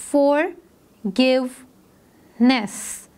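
"फॉरगिवनेस" very slowly as for, give, ness; split into syllables. Shown as a demonstration. Teacher showing three breaks to pupils, syllable by syllable. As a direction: break "फॉरगिवनेस" into three long, separate beats. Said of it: "three times हमने break लिया है बोलते वक्त".

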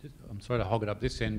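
normal talking voice

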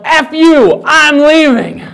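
loud, high-pitched imitated outburst; angry yell. Politician to her son at a meeting, angry yell. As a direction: yell it like someone storming out. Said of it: "and then she yelled".

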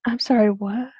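ordinary delivery

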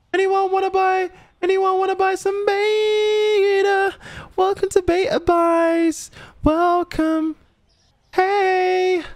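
very high falsetto, vowels stretched out; high-pitched cartoon greeter, long held notes; silly shopkeeper's call. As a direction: squeal out a sing-song welcome to customers. Calls to shoppers in silly voice.

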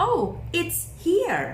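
happy discovery, "it's here" sung out; cheerful it's here. Shown as a demonstration. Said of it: "เราก็จะพูดบอกคุณแม่".

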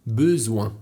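"besoin" slowly, both syllables joined; "besoin" with the s voiced as z; pronunciation demo. Shown as a demonstration. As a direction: model "besoin" slowly, y-like first vowel, then z-w-nasal e. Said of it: "Uwaga na wymowę słowa besoin".

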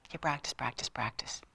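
whispered practice, practice; hushed mantra. Shown as a demonstration. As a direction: breathe it like a secret.

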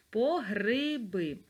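slow "По гриби" with a clear И in "гриби"; slow dictation. Shown as a demonstration. Teacher dictates "По гриби" for the spelling. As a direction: stretch "гриби" so the И is heard. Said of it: "И чуємо під наголосом".

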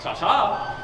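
sing-song goodbye. Acted. Villain leaves with flourish.

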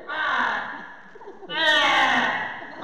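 choking, phlegmy bleat; harsh imitated baa; sheep bleat. Shown as a demonstration. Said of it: "it's like it's choking on its own phlegm".

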